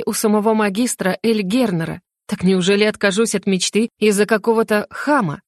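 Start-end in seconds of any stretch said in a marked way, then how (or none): none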